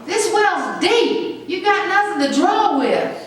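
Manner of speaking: lightly voiced doubting woman at the well; mimicking the woman. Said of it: "'Cause she said".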